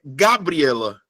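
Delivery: "Gabriela" slowly, with GA stressed, wrongly, and the rest falling; misplaced stress on the opening "Ga" of "Gabriela"; stress on first syllable. Shown as a demonstration. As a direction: punch the "Ga" in "Gabriela". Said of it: "qual é a sílaba que eu falo mais forte?".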